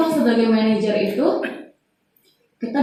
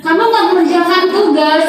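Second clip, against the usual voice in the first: higher, plaintive guilt-tripping teacher; pleading guilt-trip; 6 semitones higher, 4 dB louder than usual. Acted. Teacher reproaching a student over undone homework, pleading guilt-trip. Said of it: "nada suara memelas".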